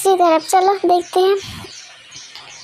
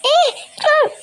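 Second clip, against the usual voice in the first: startled shriek; 7 semitones higher than usual. Acted. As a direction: shriek in shock at waking beside him. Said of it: "बहुत ज़ोर से चिल्लाती है". (घबराकर ऊँची चीख)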